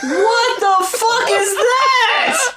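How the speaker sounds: cracking up while asking it, almost shouting; real laughing disbelief. Real, not acted. Reacting to a ridiculous lyric.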